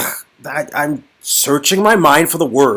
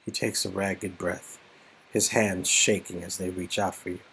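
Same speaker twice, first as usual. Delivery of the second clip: softer, lower narration; hushed narration; 10 semitones lower, 17 dB quieter than usual. A narrator's reading. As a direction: narrate the trembling hands gently.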